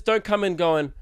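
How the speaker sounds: normal talking voice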